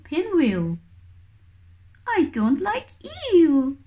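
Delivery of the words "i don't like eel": high little chick voice, pouting; fussy chick. Acted. Baby kiwi refusing weta, high and pouty. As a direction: pout it out like a picky baby bird. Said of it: "says Hooey".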